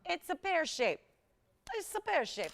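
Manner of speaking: put-on playful Italian accent, pitched high. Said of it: "I don't know why I'm talking like I'm Italian".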